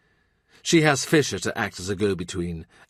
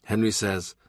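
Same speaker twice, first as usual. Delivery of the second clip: plain reading voice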